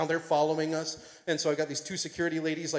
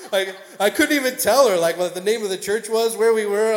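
loud, high mock-tearful outburst; comic high-pitched blubbering; hammed-up bawling; 7 semitones higher, 12 dB louder than usual. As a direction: wail it out, too overwhelmed to speak. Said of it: "I'm bawling like a".

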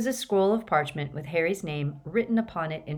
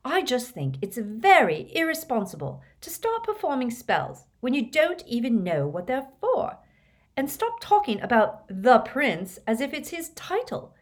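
put-on higher, prim, disapproving girl's voice; indignant Hermione; 7 semitones higher than usual. A narrator's reading. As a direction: lecture them, still stung. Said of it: "her cheeks reddening".

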